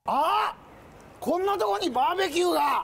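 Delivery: high, exclaimed mock surprise; наигранное удивление. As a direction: act out a sudden exciting discovery. Staged discovery of the barbecue.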